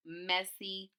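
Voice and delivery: plain reading voice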